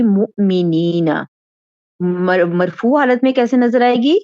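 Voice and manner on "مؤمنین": مؤمنین said very slowly, yeena ending drawn out; slow model word. Shown as a demonstration. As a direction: spell out مؤمنین slowly for students. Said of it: "یہ آپ کو جمع اس طرح سے نظر آئے گی". (مؤمنین بہت آہستہ، آخر کی یینا لمبی)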